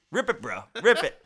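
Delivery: high loud laughing shout; mock yell, laughing.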